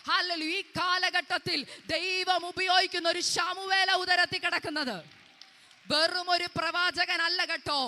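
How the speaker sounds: loud, high fervent preaching; preaching at full force. Acted. Preacher proclaiming a coming prophet to the congregation, fervent shouting.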